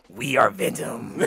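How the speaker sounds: slow, low monster growl; deep alien symbiote voice; Venom voice. Pretend. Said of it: "I could do a good Venom voice".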